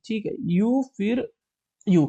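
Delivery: ordinary talk, nothing marked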